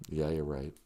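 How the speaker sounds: usual speaking voice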